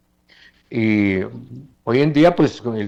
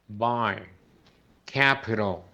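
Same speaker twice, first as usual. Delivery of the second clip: ordinary talk, nothing marked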